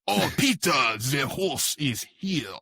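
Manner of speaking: slow, drawn-out mock French accent. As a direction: stretch the catchphrase out in a stagy French accent. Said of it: "you need to do a French accent".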